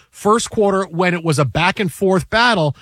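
usual speaking voice

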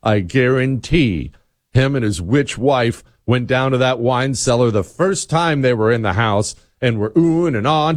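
usual speaking voice

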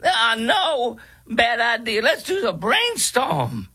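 very high, silly parody voice with giggles; mock a DEI video host, high and drawn-out; mock training-video presenter. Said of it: "This is exactly what those videos sound".

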